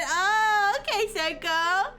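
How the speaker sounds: amused. Real, not acted. Caught out by Senko's joke, loud and amused. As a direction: react loud and tickled. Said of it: "you got me with that one".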